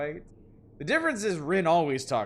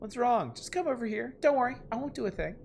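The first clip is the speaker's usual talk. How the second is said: lightly voiced creepy coaxing, higher; creepy boyfriend; 8 semitones higher than usual. Yuta coaxing Rin to come over, mock sweet. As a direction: lure her in, falsely sweet.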